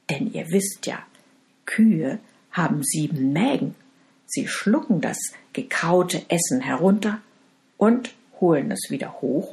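ordinary delivery, nothing marked

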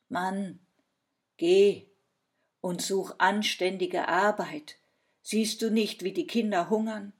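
loud, reproachful order; scolding wife. A narrator's reading. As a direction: scold your husband and send him off. Wife scolds husband, sends him off. (vorwurfsvoll schimpfend)

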